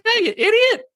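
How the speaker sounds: very high, angry New York puppet; Rage Rabbit. Acted. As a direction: yell at a bad driver in high falsetto. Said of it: "Rage Rabbit would".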